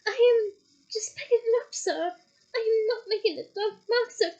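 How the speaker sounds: high weeping house-elf; tearful elf. Acted. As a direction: beg to be believed, crying. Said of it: "tears streaming down".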